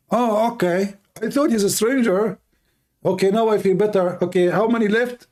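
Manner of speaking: high, mock-relieved husband voice; husband accepts the excuse, high and relieved. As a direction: play the gullible husband letting it go. Husband calmed down, acted.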